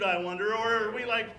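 usual speaking voice

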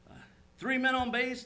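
mildly imitated excited baseball fan; lightly acted fan excitement; excited retelling. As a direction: relive the game for a listener. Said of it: "he just got all excited".

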